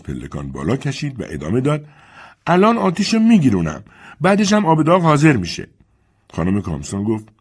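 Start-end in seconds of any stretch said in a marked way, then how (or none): 2.5-5.7 put-on high, raised old servant voice calling upstairs; Dilsey calling up. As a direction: call up the stairs to the mistress.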